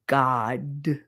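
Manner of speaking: "God" as a slow, open GAHD; articulated vowel. Shown as a demonstration. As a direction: stretch "God" out with an AH. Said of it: "and articulate it".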